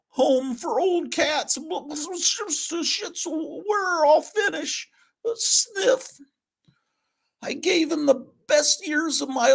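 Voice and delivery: very high, slow mock-sobbing cat; hammed-up crying cat. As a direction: whine about being doomed like a self-pitying cat. Cat Pat wails his fate.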